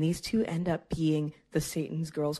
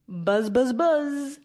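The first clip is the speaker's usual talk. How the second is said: rising buzz buzz like a horn, louder; mock car horn; 8 semitones higher, 7 dB louder, much slower than usual. Mom in pickup line honking at friend, playful buzzing horn. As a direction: honk at her playfully with your voice.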